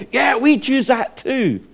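high-pitched, drawn-out rallying cry; stirred-up crowd. Retold or imitated. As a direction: imitate a crowd swept up in revival. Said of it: "the whole crowd gets stirred up".

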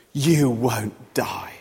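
very slow, low, drawn-out assurance; slow devilish deceit; deceiving devil. Acted. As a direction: stretch each word low, as the tempter. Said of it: "the devil".

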